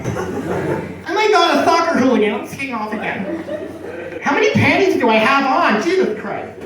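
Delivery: put-on high, excited girl voice laughing through it; swooning American girl. American college girl swooning at Cockney accent, high squeal. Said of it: "girls would just be like".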